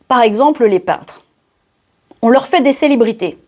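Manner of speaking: ordinary talk, nothing marked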